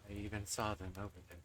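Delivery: low, soft, standoffish killer; aloof spirit voice. Acted. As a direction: murmur it, pretending not to care. Spirit character voice.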